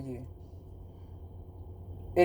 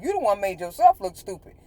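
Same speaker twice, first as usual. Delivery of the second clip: ordinary talk, nothing marked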